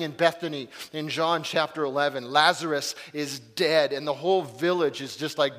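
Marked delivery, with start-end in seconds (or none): none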